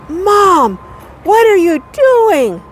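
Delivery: high, drawn-out mock yell; retold daughter's yell. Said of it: "she yells out".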